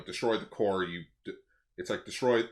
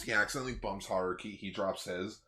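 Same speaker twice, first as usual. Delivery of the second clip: ordinary delivery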